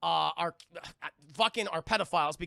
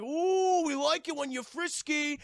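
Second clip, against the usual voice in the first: much higher, louder teasing coo; mocking creep; 9 semitones higher, 5 dB louder, slower than usual. Workmen teasing the daughter, high mocking coo. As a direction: coo it like a leering taunt.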